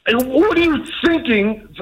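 exasperated. Real, not acted. Exasperated husband complaining.

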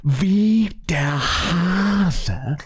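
halting, very drawn-out comic German; slow German phrase reading. Shown as a demonstration. Host milking the German phrase for laughs, exaggeratedly slow. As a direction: leave big gaps and stretch each word. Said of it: "casually and calmly read very common German phrases".

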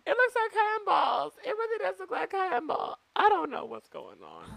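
high, incredulous reaction; aghast. Real, not acted. Host watching a terrible Netflix movie, reacting in a high incredulous voice. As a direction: gawk at the screen in disbelief.

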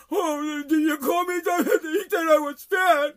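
high, drawn-out mock wailing; exaggerated blubbering kid voice; whiny crybaby. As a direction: wail like an oversensitive kid being teased. Mocking whiny, oversensitive kids.

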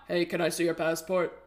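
low, quiet villain line; a hint of a movie villain; bad guy voice. Shown as a demonstration. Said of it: "who could do the bad guy voice".

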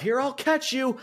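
high, hammed-up mock of wrestlers cueing each other; mocking ringside brawlers politely staging a spot, high and hammy; mock wrestler banter.

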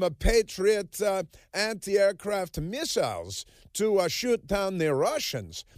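high, slow mock Eastern European accent; hammed-up foreign leader accent. Mocking the visiting president in a put-on foreign accent. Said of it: "they speak with an accent 'cause they want him to feel at home".

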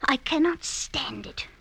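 short unvoiced whisper; whispered aside. [name] whispering her dislike of her husband's beard. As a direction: whisper it with distaste.

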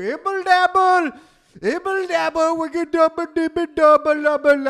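high, loud exaggerated Indian accent; mock Indian accent. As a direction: ham up an Indian accent on stage. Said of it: "make fun of an Indian accent".

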